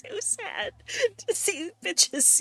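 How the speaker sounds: high mocking singsong, slow. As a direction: tease in a high nasty voice. Mocking the earlier line.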